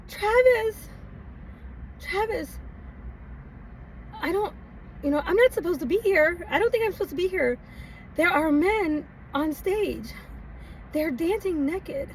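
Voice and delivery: high, hushed, anxious pleading; whisper-worried plea down the phone; nervous young self calling for help; panicked phone call. Retold or imitated. Panicked call to boyfriend from club.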